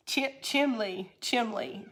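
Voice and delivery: "chimney" said as CHIM-lee; dialect pronunciation. Shown as a demonstration. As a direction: swap the N of "chimney" for an L. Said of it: "is what I would always say".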